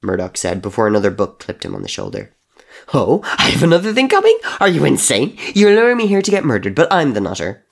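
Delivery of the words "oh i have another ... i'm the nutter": high, loud outraged shout; incredulous yell. Acted. Crow yelling back at Murdoch, high and outraged. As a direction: scream it in disbelief.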